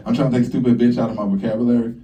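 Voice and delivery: normal talking voice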